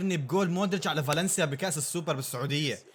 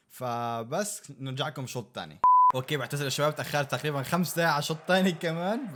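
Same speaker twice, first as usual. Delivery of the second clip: ordinary talk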